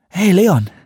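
loud, high call for attention. A narrator's reading. Delaware calls to Leon from the pool, loud call. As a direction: shout his name across the water.

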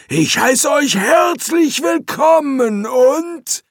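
high, slow, shouted recital; rehearsed demon speech. Acted. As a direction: bellow a learned-by-heart greeting. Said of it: "als sei ihm der Text gründlich erklärt worden".

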